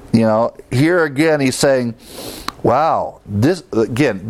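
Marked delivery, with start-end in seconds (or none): none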